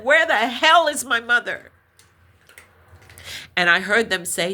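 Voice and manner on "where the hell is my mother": louder, demanding where her mother is; retold angry demand; angry outburst. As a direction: burst out in anger, raised voice. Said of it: "like, in anger and said".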